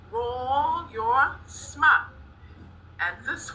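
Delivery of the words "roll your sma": very slow, high-pitched class chant; drawn-out cheer. Pretend. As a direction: stretch each word, pitch climbing.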